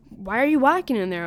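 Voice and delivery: teacher catching the narrator walking in, loud; angry teacher. Retold or imitated. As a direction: confront them sharply.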